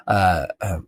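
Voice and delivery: ordinary talk, nothing marked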